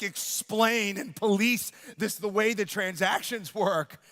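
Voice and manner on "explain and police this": ordinary talk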